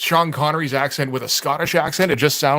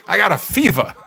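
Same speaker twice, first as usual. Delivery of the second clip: lightly voiced Walken, laughing through it; a hint of Walken's halting cadence, louder; Christopher Walken impression; 5 dB louder than usual. Host riffing for the listeners, a mild Walken voice. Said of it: "We've all, we've all heard my walking now".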